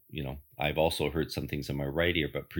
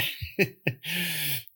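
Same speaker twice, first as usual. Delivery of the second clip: a short, high, amused laugh; 13 semitones higher than usual. Real, not acted. Laughing at his hope for lottery numbers, amused laugh. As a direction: laugh at your own daydream of lottery numbers.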